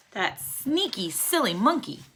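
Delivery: ordinary delivery, nothing marked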